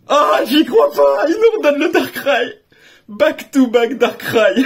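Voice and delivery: can't believe the pull; a real astonished exclamation; stunned disbelief. Real, not acted. Same rare card pulled twice.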